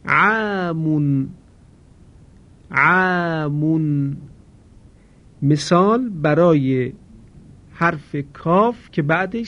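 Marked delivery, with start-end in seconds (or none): 0.1-4.2 عامون drawn out very slowly, the آ thin and light; slow Quranic recitation of عامون; recitation demo. Shown as a demonstration. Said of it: "آ قرار گرفته".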